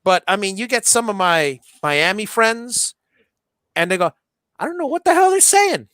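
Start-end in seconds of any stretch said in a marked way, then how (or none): 4.6-5.9 high, quick voice of bewilderment; baffled mock quote. Retelling Miami friends failing to understand, mock bafflement. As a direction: imitate friends completely lost, pitched high and fast.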